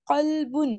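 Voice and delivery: قلبن as "qalbun" with a heavy q from the throat; full, back q in قلبن; throaty full qaf. Shown as a demonstration. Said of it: "یہ بھی ہم نے حلق سے نکالا".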